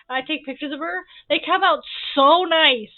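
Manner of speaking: ordinary talk, nothing marked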